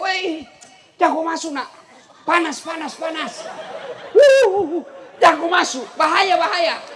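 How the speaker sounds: high, loud frantic warning to stay out; shouted warning. Retold or imitated. Chinese man yelling a warning at the villager. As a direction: yell at him not to go in, it's hot. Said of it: "Dia teriak".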